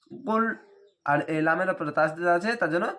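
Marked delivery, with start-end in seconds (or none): 0.1-0.4 "গুল" with a heavy throaty first consonant, stopped on the l; thick, heavy sound. Shown as a demonstration. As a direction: say "গুল" thickly and close on a still l. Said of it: "একটু মোটাভাবে". ("গুল" মোটাভাবে উচ্চারণ)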